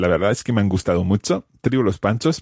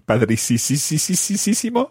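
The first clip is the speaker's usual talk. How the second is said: playful exaggeration; 7 semitones higher than usual. Learner jokes to teacher about his car, playfully exaggerated. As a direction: stretch padricisisisisísimo for comic effect. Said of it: "este carro es".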